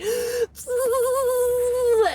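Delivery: high, drawn-out cry of pain; long high wail, acting out the agony; imitated scream. Woman as cop twists her arm, high pained scream. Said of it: "and I, like, scream".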